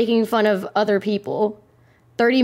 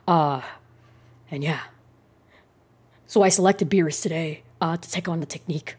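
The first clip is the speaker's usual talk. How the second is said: Lotus Asakura; 8 semitones lower, 5 dB quieter than usual. Acted. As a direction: drop low and quiet, half-mumbled channel intro. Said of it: "Best Lotus Asakura impression".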